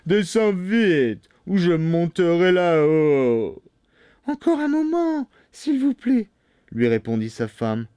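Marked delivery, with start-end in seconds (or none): 0.1-3.6 slow, drawn-out angry bellow, pitched up; full-force shout. A narrator's reading. Bluebeard ordering his wife down, shouting hard. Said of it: "criait de toute sa force". 4.3-6.3 very high, plaintive falsetto; pleading wife. A narrator's reading. Wife stalling Bluebeard, high and pleading. Wife begs her husband for time.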